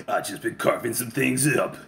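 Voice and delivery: low, soft, menacing yakuza murmur; quietly threatening, knife in hand; Tatsu's menacing voice. Retold or imitated. Ex-yakuza to a salesman, low and menacing.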